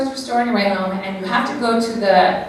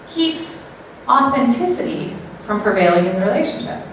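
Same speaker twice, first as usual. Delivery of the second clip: ordinary delivery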